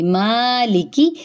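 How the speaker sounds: मालिकी slow, long मा and short की; correct recitation. Shown as a demonstration. Teacher shows the right length in मालिकी, slowly. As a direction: stretch मा in मालिकी only. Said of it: "लंबी नहीं होगी".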